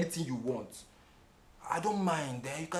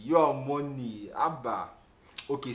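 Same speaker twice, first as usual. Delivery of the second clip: ordinary talk, nothing marked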